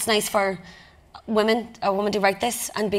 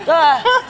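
a loud, laughing shriek, higher pitched; imitated fan shriek; 7 semitones higher, 9 dB louder than usual. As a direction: mimic a fan squealing at you in the street. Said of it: "like this".

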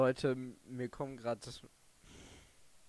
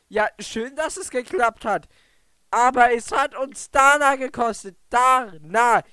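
bitter shout; 9 semitones higher, 14 dB louder than usual. Real, not acted. Grieving, bitter at the win.

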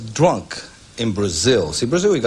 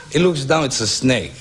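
ordinary talk, nothing marked

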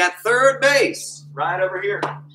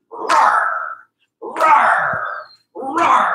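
lion roar; 8 semitones higher, much slower than usual. Shown as a demonstration. Coach roaring as his favourite animal for the class. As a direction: roar like a big cat for the kids. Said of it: "act like your favorite animal".

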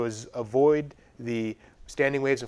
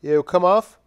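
normal talking voice

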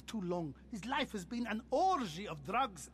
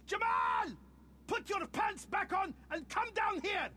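shouting for his cousin; 8 semitones higher than usual. Acted. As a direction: call him down sharply, annoyed. Calling cousin down from upstairs.

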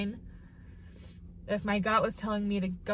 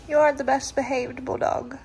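louder gushing praise for the dog; doting pet talk; 4 semitones higher, 7 dB louder than usual. Real, not acted. Praising her bulldog.

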